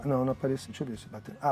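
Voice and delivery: ordinary talk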